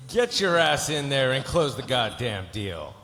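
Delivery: loud, slow, hard command; forceful order. Acted. Lawyer ordering client to close deal, slow and loud. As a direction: order it out, spacing the words.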